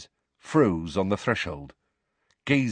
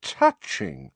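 slow, high mocking sneer; 10 semitones higher, slower than usual. A narrator's reading. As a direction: drip with mock sympathy. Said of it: "Snape sneered".

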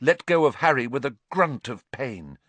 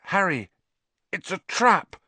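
urgent warning; 8 semitones higher than usual. Acted. Injured Ron warns Harry.

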